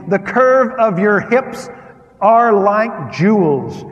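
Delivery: loud, lifted reading of the verse; preaching scripture. Acted. Scripture read aloud with force.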